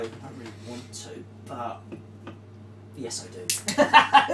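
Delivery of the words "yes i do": gleeful. Real, not acted. Admits wanting big ship.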